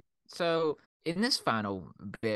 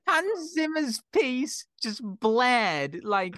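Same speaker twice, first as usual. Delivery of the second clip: high, loud, cracking up while telling it; real incredulous hilarity; 7 semitones higher, 6 dB louder than usual. Real, not acted. Friend recounting the blaring cinema theme, real hilarity. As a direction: push it out high through helpless amusement.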